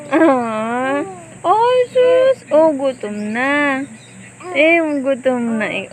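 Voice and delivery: ordinary delivery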